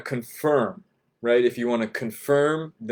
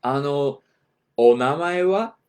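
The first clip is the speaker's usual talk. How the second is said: "onamae wa" high and louder, lifting up on "wa"; rising question pitch; 4 semitones higher, 6 dB louder than usual. Shown as a demonstration. Teacher showing how to ask a name, pitch rising on "wa". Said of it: "raise the pitch of your voice at the end".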